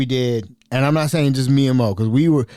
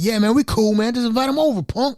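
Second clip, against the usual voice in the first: higher, hammed-up bully voice pushing me around; mock Debo bully; 9 semitones higher than usual. Mo pressuring me to invite Mel, in a mock bully voice. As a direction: play the big bully bossing his friend. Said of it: "Okay, Debo".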